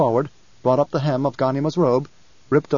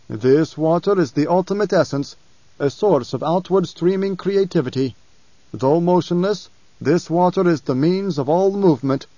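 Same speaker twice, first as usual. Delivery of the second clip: a higher, solemn reading of an inscription; reading aloud; 3 semitones higher than usual. Acted. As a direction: intone the sacred words with awe. Stilgar reads jar inscription aloud.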